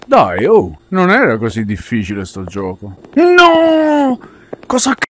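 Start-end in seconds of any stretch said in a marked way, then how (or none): 3.2-5.0 high, loud, slow disbelieving cry; dismayed shout. Real, not acted. Failed the level.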